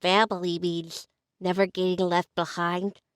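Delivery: cute, stuffed-nose little voice, softening at the end; super cute character voice. Shown as a demonstration. Student running the whole line, cute character voice. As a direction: go small and cute, fading softly on the last words. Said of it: "it's a super cute voice".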